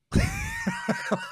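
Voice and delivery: high, helpless laugh; real laugh. Real, not acted. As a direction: crack up at the retold line. Laughing at brother's confession.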